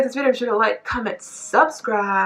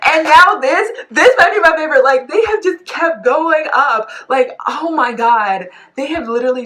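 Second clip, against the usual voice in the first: much higher and louder, bursting with delight; excited gushing; 9 semitones higher, 10 dB louder than usual. Real, not acted. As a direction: gush about a favourite song, slowing down. Gushing over the new song.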